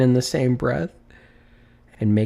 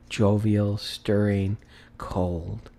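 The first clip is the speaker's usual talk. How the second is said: usual speaking voice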